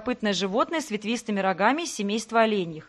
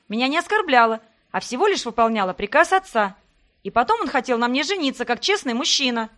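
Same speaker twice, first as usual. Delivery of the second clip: louder, higher furious retort; shouting back; 3 semitones higher, 4 dB louder than usual. Acted. Heroine shouts back at Sebastian in their fight. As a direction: yell back, refusing to cry.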